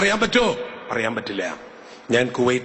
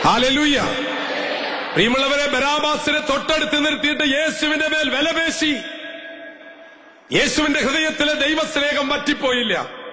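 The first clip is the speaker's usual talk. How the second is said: preaching at full force. Acted. Preacher proclaims faith to the congregation at full force. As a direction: proclaim it to the whole church.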